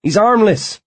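high, loud pleading insistence. A narrator's reading. Tachyon pleading with bouncer.

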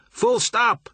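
high called order; calling out. A narrator's reading. Pilot yelling to the engine room, high call. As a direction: pilot calling the halt loudly. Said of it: "he called".

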